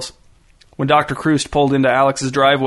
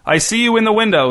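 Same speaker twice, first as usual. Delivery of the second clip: high, projected police call; megaphone voice; 8 semitones higher than usual. A narrator's reading. Cop addresses gunman at the window, megaphone voice. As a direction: hail them as if through a megaphone. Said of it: "said the cop through the megaphone".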